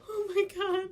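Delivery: starstruck. Retold or imitated. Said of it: "and you're like".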